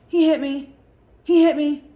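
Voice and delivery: put-on loud, high whiny baby voice; baby talk. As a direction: imitate a drunk friend talking like a baby. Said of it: "she's talking like a baby".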